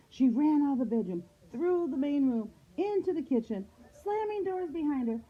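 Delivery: high-pitched, urgent story reading; high, frantic storytelling; panicked narration. A narrator's reading. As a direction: tell the chase in a high, breakless panic.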